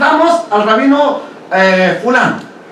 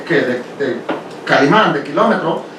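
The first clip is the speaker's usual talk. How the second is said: ordinary talk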